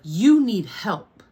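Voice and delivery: loud, slow, drawn-out angry rebuke; angry retort. Retold or imitated. Grandparents angry at her calm reaction, loud imitated rebuke. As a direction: imitate them angrily, stretching it loud and high. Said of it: "angry because of my reaction".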